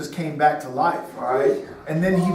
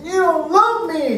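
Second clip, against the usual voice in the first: put-on higher, louder whiny child voice; upset little boy; 10 semitones higher, 4 dB louder than usual. Little son telling daddy he is unloved, whiny child voice. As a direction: play the hurt little son. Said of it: "He goes".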